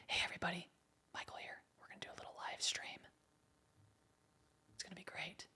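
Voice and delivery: soft unvoiced ASMR whisper. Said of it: "I'll just do an A S M R live stream".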